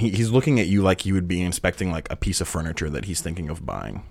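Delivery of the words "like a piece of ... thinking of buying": normal talking voice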